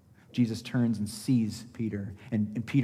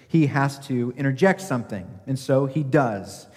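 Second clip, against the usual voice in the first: usual speaking voice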